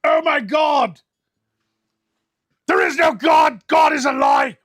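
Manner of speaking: anguished, high shouted outburst; Devil Pup. Acted. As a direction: cry it out loudly, slowing on the denial. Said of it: "Devil Pup says".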